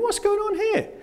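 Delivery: appalled guest in a posh hotel; high, hammed-up disbelief; indignant disbelief. Posh hotel, vomit found.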